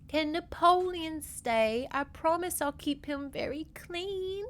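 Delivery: put-on high, small child voice; little girl voice. Niece asking her uncle if her frog can stay, high child voice. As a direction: ask sweetly like a small girl. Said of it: "little Elsie is like".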